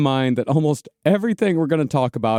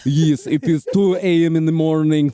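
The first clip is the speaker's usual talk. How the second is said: mock vampire voice; 2 semitones higher than usual. Parodying vampire clan speech.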